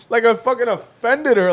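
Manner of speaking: ordinary talk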